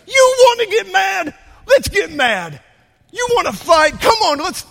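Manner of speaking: high, loud furious yell at the crowd; mayor provoking an angry crowd with a hammed-up shout. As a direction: explode at the room, spoiling for a fight. Mayor acts furious before a crowd.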